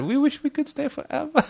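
very high mock Korean accent with a laugh; giggly, exaggerated Korean-accented English. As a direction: quote the review in a squeaky put-on Korean accent. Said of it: "Sorry for the bad accent".

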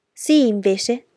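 yelled contradiction. A narrator's reading. Boy contradicting his cousin before grandmother, yelled. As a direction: yell back insistently. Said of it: "urlò Francis".

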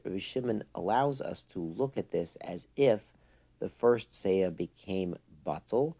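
usual speaking voice

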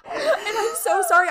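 laugh-crying. Real, not acted.

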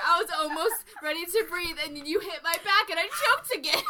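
ordinary talk, nothing marked

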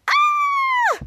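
piercing high shriek; a very high startled scream; squashed scream. Retold or imitated. Charlotte crushed under Ray on the couch, piercing shriek.

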